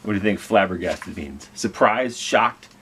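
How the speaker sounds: plain reading voice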